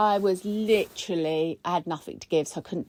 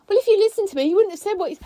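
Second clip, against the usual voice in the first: high, raised mock complaint; retold argument; 12 semitones higher, 4 dB louder, much faster than usual. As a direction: imitate the partner mid-row. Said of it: "she's going".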